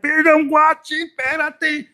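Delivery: high, loud, impatient brush-off; loud retort. Acted. Watcher telling someone to wait, high loud retort. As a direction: hold them off loudly.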